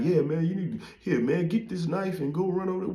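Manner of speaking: high-pitched mock urging; imitated instigator. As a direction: act out an old inmate giving a youngster orders. Older inmate sending youngster to fight.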